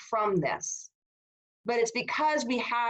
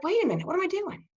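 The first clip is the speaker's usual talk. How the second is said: quick, high-pitched self-check; catching oneself; 9 semitones higher, much faster than usual. Retold or imitated. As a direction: act out suddenly coming to your senses. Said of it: "I had to catch myself".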